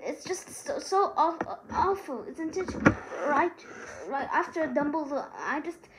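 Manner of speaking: halting through tears after a loss; tearful. A narrator's reading. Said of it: "She blew her nose and hiccuped".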